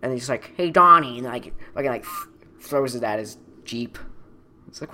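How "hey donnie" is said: loud call across the yard; playful loud hail. Retold or imitated. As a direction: shout his name to get his attention. Tucker calls out to Donnie.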